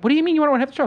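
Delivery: imitated indignant question, pitched high; mock incredulous. Producers balking at his ownership demand, mock incredulous.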